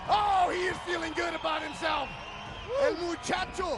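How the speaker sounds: high, loud, thrilled commentary; excited play-by-play. Real, not acted. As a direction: yell the win like the crowd's on its feet. Commentator calls the win live.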